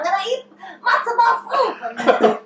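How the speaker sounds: high, slow hammed-up oldster; old person voice. Old person planning lunch out, high slow playful voice. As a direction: draw it out like a batty old diner.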